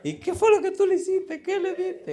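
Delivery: husband voicing his alarmed wife; mock wife. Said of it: "wife asks what he gave".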